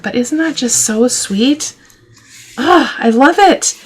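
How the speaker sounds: high, drawn-out gush of delight. Real, not acted. Crafter admiring her finished wallet for viewers, delighted gush. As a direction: coo over the finished wallet, slowly.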